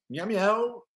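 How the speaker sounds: playful high cat meows; meowing. Pretend. Streamer greets a viewer with playful high cat meows. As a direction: mew like a kitten at a viewer.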